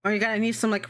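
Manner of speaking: ordinary talk, nothing marked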